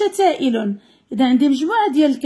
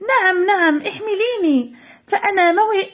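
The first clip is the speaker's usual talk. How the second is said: higher, eager happy yes; joyful cloud; 6 semitones higher than usual. Acted. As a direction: bubble over with joy. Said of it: "فقالت الغيمة بفرح".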